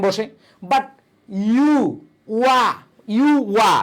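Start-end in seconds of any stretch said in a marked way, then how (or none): 1.5-3.7 "ইউ" and "ওয়া" as separate sounds; teacher sounding out "ইউ", "ওয়া"; sound demo. Shown as a demonstration. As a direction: isolate "ইউ" (yu), then "ওয়া" (wa), pausing between. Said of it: "এগুলোর পূর্বে আমাদের কি হবে?".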